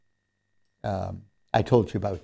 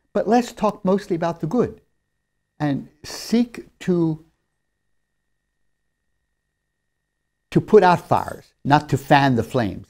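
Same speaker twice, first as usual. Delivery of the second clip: louder, higher preaching voice; 4 semitones higher, 6 dB louder than usual. Acted. Preacher urges the congregation to keep tongues from evil, preaching hard. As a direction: rise up and press the point home. Sermon exhortation at raised voice.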